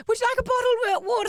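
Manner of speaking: hammed-up air hostess; mock flight attendant. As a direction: fawn over the star. Mock serving [name].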